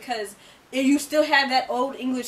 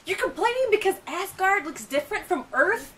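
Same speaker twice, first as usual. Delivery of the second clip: exasperated; 9 semitones higher, 3 dB louder than usual. Retold or imitated. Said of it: "I'm just like".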